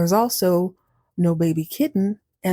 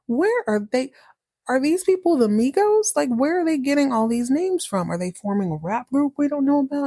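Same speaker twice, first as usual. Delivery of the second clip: higher-pitched, mounting disbelief; incredulous questioning; 6 semitones higher than usual. Acted. Mouse demanding where cat's odd names come from, incredulous. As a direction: pile up baffled questions about something absurd.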